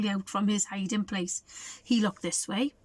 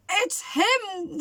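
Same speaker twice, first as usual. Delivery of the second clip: whimpered; 7 semitones higher than usual. Acted. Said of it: "whimpered the elephant".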